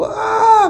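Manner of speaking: high-pitched mock scream; imitated scream. Retelling the wife screaming from the bedroom, imitated high scream. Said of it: "Dia teriak begitu".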